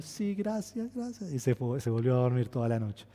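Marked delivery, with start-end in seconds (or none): 0.0-1.3 put-on high, soft little-girl voice; imitating a sleepy daughter; small child thanks. (imitando a la hija que agradece, bajito)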